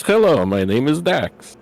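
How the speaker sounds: deliberate, slowing foreign-sounding greeting; big gentle cat warrior's careful hello; friendly cat-man. Acted. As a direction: introduce yourself simply and earnestly, word by word. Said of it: "smiles at everyone and says".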